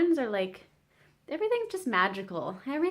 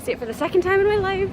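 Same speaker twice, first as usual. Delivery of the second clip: higher, louder and fast with eagerness; excited; 10 semitones higher, 5 dB louder, faster than usual. Real, not acted. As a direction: burst out with real excitement. Excited to revisit Notre Dame.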